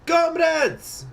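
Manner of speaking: put-on very high, loud Russian-accented call; calling out comrades. Sablin calling for every eye, loud Russian call. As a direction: call the whole room to attention in Russian accent. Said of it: "called Sablin".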